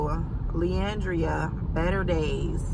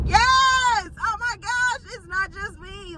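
acted-out thrilled relief, pitched way up; excited agreement; 14 semitones higher, slower than usual. Excited reply to a comment.

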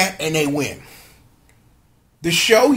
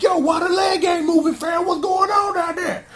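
high-pitched baffled questioning; acting out puzzled alarm, pitched up; mock alarm; 11 semitones higher than usual. Recapper voicing Birdman asking why the leg won't move, mock alarm.

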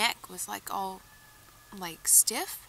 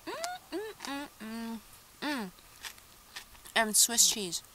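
words muffled by food; talking while chewing; 3 semitones higher, slower than usual. Real, not acted. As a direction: mouth full of sandwich. Seen 0: Bit sandwich at -5, chews and talks throughout the labelled words.

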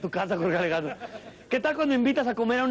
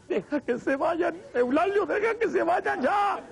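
higher pleading mother's voice; mother pleading with the father to let the kids leave; pleading mom; 7 semitones higher than usual. Acted. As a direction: beg the father to let them go. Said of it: "Y la jefa es".

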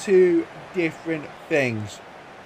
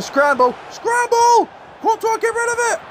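rising panic, yelling higher and fast; excited yelling; 3 dB louder than usual. Real, not acted. Player watching his box yelling at his keeper, frantic shout. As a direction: scream the scramble out.

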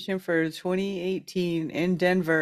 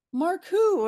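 puzzled question; 10 semitones higher than usual. Retold or imitated. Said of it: "And they go".

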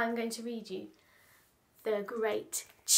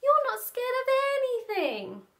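put-on high, loud childish whine; exasperated complaint; 11 semitones higher, 7 dB louder than usual. A narrator's reading. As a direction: wail it as a frustrated little elephant. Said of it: "sighed Patrick".

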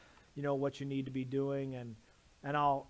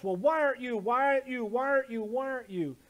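mock nagging husband; 8 semitones higher, 5 dB louder than usual. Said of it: "maybe she's saying the same thing".

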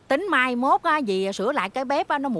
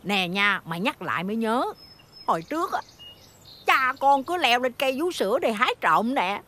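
usual speaking voice

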